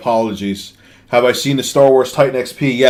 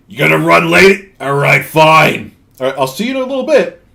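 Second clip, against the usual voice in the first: put-on old smoker woman, louder and irked; imitated old woman; 4 semitones higher, 3 dB louder than usual. Compressor seller answering a buyer running late, mock old-woman voice. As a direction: mimic a cross old woman, voice pushed up. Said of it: "the woman, she sounded like this".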